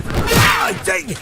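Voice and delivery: sharp pained shout; pained yelp. Acted. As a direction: cry out in pain. Hit in the face.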